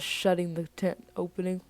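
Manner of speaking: ordinary delivery, nothing marked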